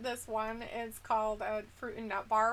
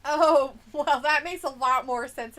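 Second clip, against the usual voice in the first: usual speaking voice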